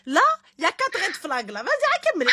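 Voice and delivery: high exasperated outburst; excited. Real, not acted. Outraged at four red flags. (exaspérée)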